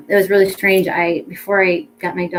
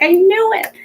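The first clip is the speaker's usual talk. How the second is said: triumphant I knew it; 13 semitones higher, 5 dB louder than usual. Retold or imitated. Retold triumph on the dog's return.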